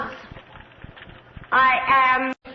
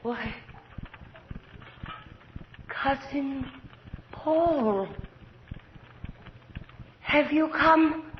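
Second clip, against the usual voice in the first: sudden hush; 7 dB quieter than usual. Acted. Countess suddenly soft, dreamy.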